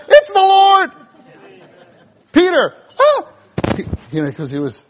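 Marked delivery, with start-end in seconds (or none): 0.1-0.9 high, loud cry of recognition; excited cry. Retold or imitated. John recognizing the Lord from the boat, excited shout. 2.3-3.3 high, loud, laughing gasp of surprise; startled cry. Retold or imitated. Peter realizing it's the Lord, startled laughing cry.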